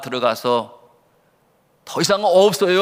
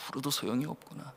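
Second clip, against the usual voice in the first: very soft, quicker murmur to oneself; resigned self-talk; 3 semitones lower, 17 dB quieter, faster than usual. Retold or imitated. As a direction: give up quietly, almost under your breath. Orphan's realisation repeated to self. (혼잣말처럼 아주 작게 체념하는 말투)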